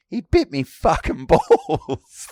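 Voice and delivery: slow, loud, laughing outrage; laughing outraged yell. Retold or imitated. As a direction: bellow it, half laughing. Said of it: "just going".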